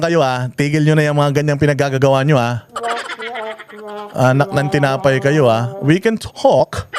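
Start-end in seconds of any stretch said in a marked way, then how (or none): 5.8-6.9 very drawn-out fake British vowels; mock British accent. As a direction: stretch it out in a parody British accent. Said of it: "naglalagay ng accent sa mga English". (pinahabang pekeng British accent, nang-aasar)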